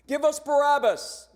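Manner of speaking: loud, high demanding shout; mob yell. Acted. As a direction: yell it like an angry crowd. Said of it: "they yelled".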